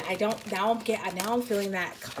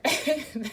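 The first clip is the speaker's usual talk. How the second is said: a brief amused laugh; real short laugh; 7 semitones higher than usual. Real, not acted. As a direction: laugh despite yourself. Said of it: "I don't mean to laugh".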